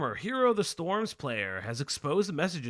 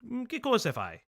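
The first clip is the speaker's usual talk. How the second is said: put-on Italian accent; 8 semitones higher than usual. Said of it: "thick Italian accent".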